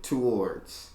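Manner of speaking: "towards" pronounced too-WARDS; imitated pronunciation. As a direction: copy "towards" with a full oo. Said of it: "See if she said".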